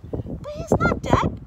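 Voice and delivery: high, loud, drawn-out protest; boy crying out. A narrator's reading. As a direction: cry out in a child's high voice. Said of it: "cried the boy".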